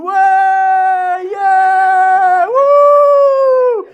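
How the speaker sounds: high, loud sung cheer; cheering neighbours. Retold or imitated. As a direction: whoop like a street crowd celebrating. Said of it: "neighbours outside going".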